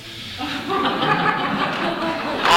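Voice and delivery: airy laugh with no voice; wheezing giggle; breathy laugh. Real, not acted. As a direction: laugh under your breath. Laughing at his own story.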